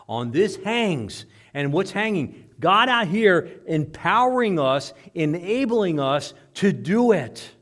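loud, high, slow pulpit proclamation; full-force preaching. Real, not acted. Preacher proclaiming God empowering us, full-force preaching. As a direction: ring out each phrase with force.

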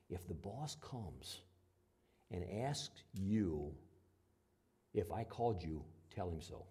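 hushed and slow, half whispered; quiet aside. Retold or imitated. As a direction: lean in and say it under your breath. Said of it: "he says quietly".

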